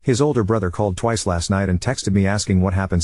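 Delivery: plain reading voice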